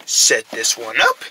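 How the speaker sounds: deep, slow voice; low, slow voice. Acted. Low voice, then high take.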